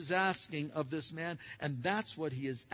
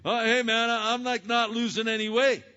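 mock complaint; 4 semitones higher, 8 dB louder than usual. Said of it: "I say".

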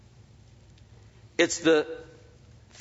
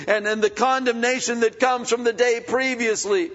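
raised, earnest prayer to the Lord; fervent prayer; 10 semitones higher, 4 dB louder than usual. Real, not acted. Pastor praying aloud to the Lord, fervent. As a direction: pour out thanks to God.

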